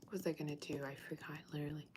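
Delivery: murmured under the breath to herself; whisper. Real, not acted. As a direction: whisper it as if thinking aloud. Muttering to herself, forgot something.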